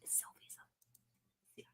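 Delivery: slow spooky whisper. Pretend. Afraid the spies will come, a slow hushed whisper. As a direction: breathe the name like it's forbidden. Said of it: "I don't wanna say her name again".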